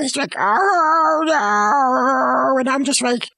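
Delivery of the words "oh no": long drawn-out mock moan; wailing, hammed-up moan. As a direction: imitate a victim moaning, stretching each vowel. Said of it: "he starts moaning".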